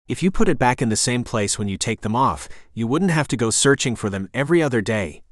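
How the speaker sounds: plain reading voice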